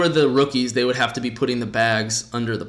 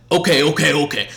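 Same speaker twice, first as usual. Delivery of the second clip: put-on old guy dismissive; 4 semitones higher, 5 dB louder, faster than usual. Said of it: "It was more like".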